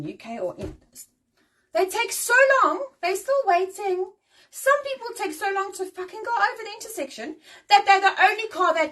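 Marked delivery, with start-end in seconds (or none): 1.7-8.9 exasperated rant. Real, not acted. Complaining about slow drivers.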